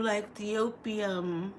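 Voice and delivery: ordinary talk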